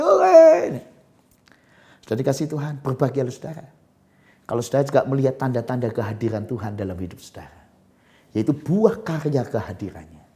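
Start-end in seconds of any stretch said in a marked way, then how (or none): none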